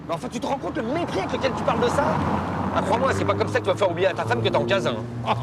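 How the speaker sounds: high-pitched indignant outburst; getting carried away. Acted. Scolding him for his contempt of the artwork, getting carried away. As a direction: lose your temper and scold him. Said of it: "Je m'emporte parce que".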